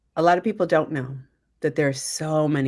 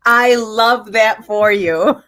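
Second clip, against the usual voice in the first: laughing; 5 semitones higher, 9 dB louder, much slower than usual. Real, not acted. Laughing delight at guest's news.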